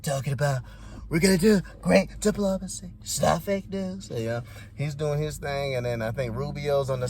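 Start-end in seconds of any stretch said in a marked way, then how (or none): 1.1-4.1 put-on presidential voice, slowing at the end; Trump impression. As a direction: lightly caricature a president's slogans. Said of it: "Trump just sitting down there".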